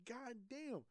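high, drawn "god damn"; retold amazement. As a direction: marvel at the speed, pitched well up.

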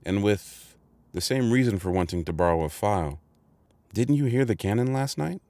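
a hint of anxious politeness; anxious and delicate. A narrator's reading. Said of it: "I was very anxious to put this delicately".

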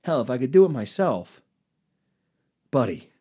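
ordinary delivery

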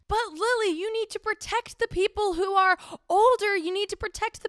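high, loud whiny imitation of critics; mock pleading. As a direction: plead loudly, mocking the complainers. Mocking people who message her.

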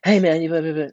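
mock sales hustle, words tumbling into blah-blah; salesman-like pitch. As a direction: call out like an eager street salesman. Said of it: "they were real salesman-like".

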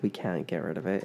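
ordinary delivery

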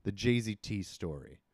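usual speaking voice

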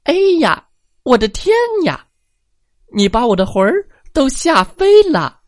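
high, fast squeaky granny shriek; the little old lady jumps with fright; tiny old lady crying out in fright; frightened old granny. Acted. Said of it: "她喊道".

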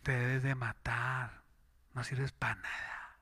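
low, soft, slow tempting voice; sly devilish murmur; devil's whisper. Acted. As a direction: hiss it like the devil in your ear. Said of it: "el diablo ... le dice".

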